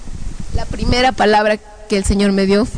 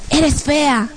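higher-pitched demonic taunt; horrible demon voice; 5 semitones higher than usual. Retold or imitated. Demons insulting her looks, horrible voice. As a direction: jeer it like a tormenting demon. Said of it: "unas voces horribles que decían".

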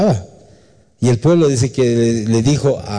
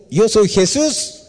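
solemn divine answer; 9 semitones higher, slower than usual. Acted. Jesus answers Saul.

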